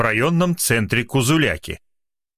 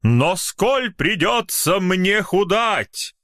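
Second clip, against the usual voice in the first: slow, lofty, higher declaiming; with pathos; 8 semitones higher, much slower than usual. Acted. Poet goes on to the club with pathos, slow and high. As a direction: lift the hand and declaim it grandly. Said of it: "с пафосом продолжает".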